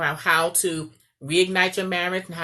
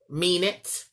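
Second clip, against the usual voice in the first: normal talking voice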